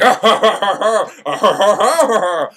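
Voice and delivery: loud, pulsing mock-villain laughter; maniacal laugh. Crazy sailor throwing Jacques off boat, maniacal laugh. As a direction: cackle in bursts like a madman.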